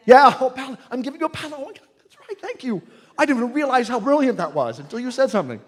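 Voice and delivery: sudden high, thrilled burst of praise; light up at the student's word choice; delighted surprise. Real, not acted.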